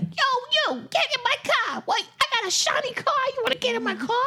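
high-pitched mock wiseguy voice; Joe Pesci. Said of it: "turned into, like, Joe Pesci".

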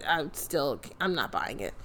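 quiet, deadpan no; low dismissal. Real, not acted. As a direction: drop it low, not buying it. Dismissing Carmella.

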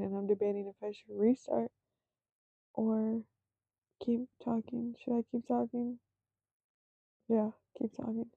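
whispered aside to herself; whispering. Real, not acted. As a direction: drop to a whisper, thinking aloud.

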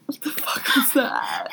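shocked whisper. Real, not acted. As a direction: whisper it in disbelief. Shocked at friend's accent.

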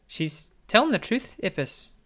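high, loud, urgent Eldith voice; earnest friend. Acted. Eldith telling Apis his mother is right, insistent. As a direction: back her up, insistent.

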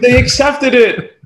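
high, loud shocked squeal; panicked disbelief. Retold or imitated. Telling a friend the deal went through, shocked high squeal. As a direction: blurt it out in shock, high and slow. Retelling panicked morning text.

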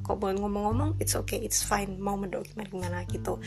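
ordinary talk

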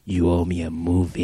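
low, grave gunslinger delivery, half-joking; Clint Eastwood. Retold or imitated. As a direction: growl it low like a tough western hero. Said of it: "You keep doing that part very well".

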